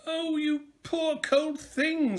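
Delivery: high, slow, tender lady's voice; the kind lady. A narrator's reading. Lady finding a sick hedgehog, high and tender. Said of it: "said the lady".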